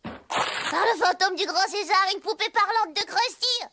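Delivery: thrilled. Acted. Child thrilled by gift.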